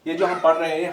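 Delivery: ordinary talk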